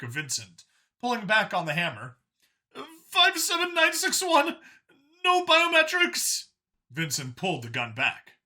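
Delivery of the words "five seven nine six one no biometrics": high, loud alien yelp; a sharp startled what; startled Xeno. A narrator's reading. Xeno caught off guard by Vincent, high startled yelp.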